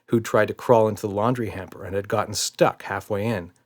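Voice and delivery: plain reading voice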